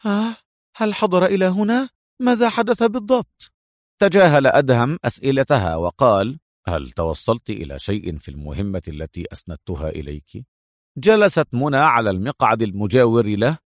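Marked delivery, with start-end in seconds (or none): 0.1-3.5 high-pitched startled questions; astonished. A narrator's reading. Mona learns the bald man came here, astonished. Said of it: "أطلقت منى صيحة دهشة". 6.7-10.5 low, soft, unruffled question; businesslike agent. A narrator's reading. Adham asks Mona about her assignment, unruffled.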